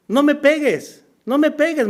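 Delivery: put-on a boy cowering, begging not to be hit; child begging. Child begs mother not to hit.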